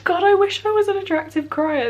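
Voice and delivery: real crying, sobbing through words. Real, not acted. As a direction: voice cracking at breaking point. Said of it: "I'm gonna stop crying now".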